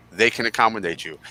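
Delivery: normal talking voice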